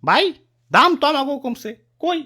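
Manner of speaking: normal talking voice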